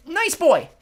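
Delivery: high, loud coaxing cry. A narrator's reading. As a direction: cry out eagerly to the passenger. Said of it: "Anger cried".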